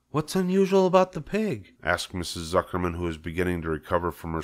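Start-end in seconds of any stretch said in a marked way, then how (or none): 0.1-1.7 much higher woman's voice; wife voice. Acted. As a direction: ask as a startled wife.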